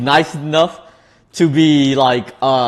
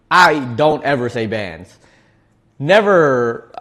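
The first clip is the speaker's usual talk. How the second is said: normal talking voice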